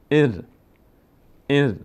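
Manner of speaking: «ار» as "er", one syllable closing on r; joined sukun syllable. Shown as a demonstration. Teacher demonstrating silent ra, joined to alef. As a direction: run the short e straight into r in ار. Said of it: "نیاز داره به کسرهٔ".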